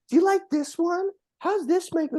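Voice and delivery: high, coy imitation of her modeling lingerie; teasing girlfriend. Retelling date showing off lingerie, high coy imitation. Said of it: "starts modeling lingerie and coming out and like".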